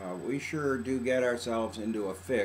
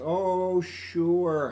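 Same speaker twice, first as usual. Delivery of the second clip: sarcastic wife; 6 semitones higher, 5 dB louder than usual. Retold or imitated. Wife's grudging reply.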